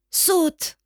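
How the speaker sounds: appel plus aigu, insistant; crié. A narrator's reading. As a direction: relance l'ordre d'en bas, plus haut. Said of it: "crie encore mon frère". (le frère crie encore d'en bas, insistant)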